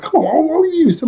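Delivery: very high, hurried mock protest; imitated hysterics, pitch shooting way up; going crazy. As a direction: mimic a worked-up girl protesting. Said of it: "she started going crazy like that".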